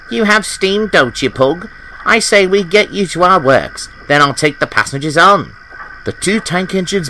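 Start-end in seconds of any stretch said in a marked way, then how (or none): 0.1-5.5 higher, eager character voice; keen Percy. A narrator's reading. Percy pitches a plan to Pug, keen and higher. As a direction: propose it brightly with raised pitch.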